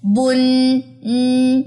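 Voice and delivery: بن with the ن drawn out into a humming nasal ن; نون غنہ کی آواز کھینچ کر; hidden noon sound. Shown as a demonstration. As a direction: hold the ن of بن as a ringing nasal hum. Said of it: "یہ آخر میں نون کی آواز آ رہی ہے".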